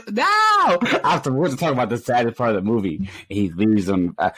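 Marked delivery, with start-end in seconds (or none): 0.1-0.8 high loud no. Real, not acted. Gamer yelping as his friend drops out, loud and high.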